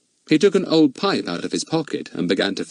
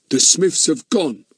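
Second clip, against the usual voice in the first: slow, curt dismissal; 7 semitones higher, 3 dB louder, slower than usual. A narrator's reading. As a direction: cut him off coldly. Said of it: "said the man curtly".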